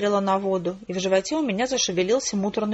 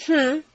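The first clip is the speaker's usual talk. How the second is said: higher-pitched "hm"; pondering hum; 7 semitones higher than usual. A narrator's reading. Father reading a lab report, humming to himself. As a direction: hum it as a thoughtful grunt. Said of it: "промычал отец".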